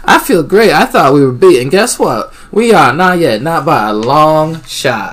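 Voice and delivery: high, loud southern twang; elated southern-twanged boasting. A narrator's reading. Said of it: "I like that Cassie's little southern twang".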